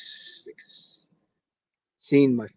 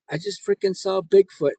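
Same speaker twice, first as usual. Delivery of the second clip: higher, breathless news of the sighting; excited blurt; 8 semitones higher, 3 dB louder than usual. Retold or imitated. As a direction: blurt it out like you're still shaken. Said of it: "I said".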